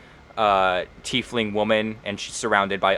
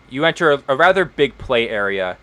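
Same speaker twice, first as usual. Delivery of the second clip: usual speaking voice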